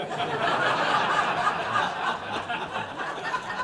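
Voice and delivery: loud burst of sheepish laughter; embarrassed laugh. Real, not acted. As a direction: caught out and laughing it off. Laughing at Lent interruption.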